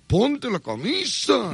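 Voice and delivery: high, slow mocking order; hammed-up official ordering him to dress; mock authorities. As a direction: put on the officials' stern high voice. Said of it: "como diciendo".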